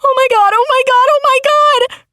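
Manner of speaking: very high, fast frantic cries; horrified. A narrator's reading. Narrator pounds the wheel after the crash, frantic high cries. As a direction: rattle them off in horror.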